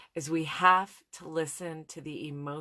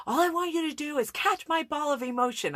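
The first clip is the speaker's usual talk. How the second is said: high, louder exasperated plea; insist, pitched up in frustration; frustrated plea; 11 semitones higher, 4 dB louder, faster than usual. Retold or imitated. Wife pleading with her husband, pitched high and exasperated.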